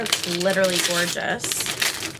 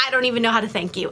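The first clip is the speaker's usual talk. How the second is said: very fast, run-together words; hurried, overwhelmed gratitude; rushed thanks; much faster than usual. Real, not acted. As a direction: blurt out the thanks in a rush. Repeats thanks, first rushed.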